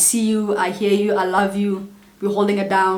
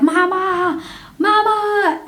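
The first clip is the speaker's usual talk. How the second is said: put-on high, wailing child's cry for mama; mock-wailing little kid calling mama; retold child's scream for mom; 10 semitones higher, much slower than usual. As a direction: scream for mama like a panicked toddler. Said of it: "screaming and shouting for my mom".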